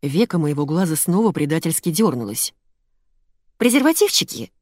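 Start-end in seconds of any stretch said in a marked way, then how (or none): 3.6-4.5 high, conspiratorial little question. A narrator's reading. Pharmacist Lena checking a naughty guess, conspiratorially. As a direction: lift it high like a shared naughty secret. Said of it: "заговорщически зашептала Лена".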